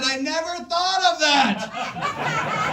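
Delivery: high, slow dreamy voice; dreamy Southern lady. Retold or imitated. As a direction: float it out, amazed. Crystal's reply, retold.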